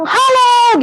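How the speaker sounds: lifted, eager hello; bright high greeting call. Shown as a demonstration. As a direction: sing out a cheery hello. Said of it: "adik-adik bilang".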